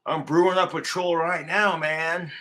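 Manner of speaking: usual speaking voice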